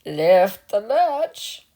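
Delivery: drawn out call from a sickbed; grandmother calling out. A narrator's reading. Grandmother calling to the visitor to let herself in. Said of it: "called out grandmother".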